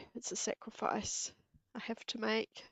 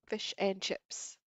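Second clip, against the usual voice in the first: ordinary delivery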